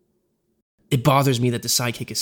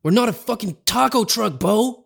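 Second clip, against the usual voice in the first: higher, a mild mock outburst; exploding; 8 semitones higher than usual. Josh exploding at Bo over burritos, mild mock outburst. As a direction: snap at him, understated anger. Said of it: "Josh explodes".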